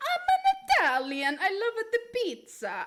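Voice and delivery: high, hammed-up Italian accent; mock Italian. Streamer joking after a failed Russian, exaggerated Italian accent. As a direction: ham it up as a cartoon Italian. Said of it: "that sounds more Italian".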